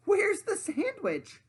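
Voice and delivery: high, slow, loud begging whine; pleading pig. Acted. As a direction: whine it, desperate for food.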